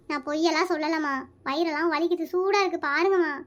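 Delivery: low, soft plea to mother; feeble sick pleading. Acted. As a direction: plead quietly as if poorly. (நோயாளி போல மெதுவான கெஞ்சல்)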